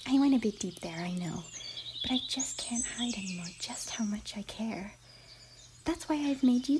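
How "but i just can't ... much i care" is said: unvoiced, soft, close confession; a shy pony whispering her feelings to her friend; intimate whisper. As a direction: whisper it right into their ear. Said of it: "Her voice had become a whisper by now".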